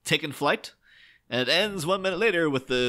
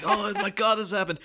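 mock alarm; 8 semitones higher than usual. Retelling onlookers at boat sinking.

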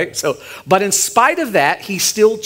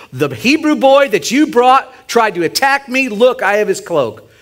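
higher, louder, mock outrage; accusing wife; 7 semitones higher, 4 dB louder than usual. Potiphar's wife accusing Joseph to her husband, higher and louder in mock outrage. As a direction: act out an indignant false accusation.

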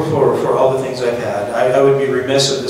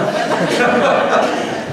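breathy laugh; 11 semitones higher than usual. Real, not acted. Laughs at own story.

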